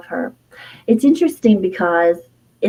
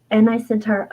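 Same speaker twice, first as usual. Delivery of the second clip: ordinary talk, nothing marked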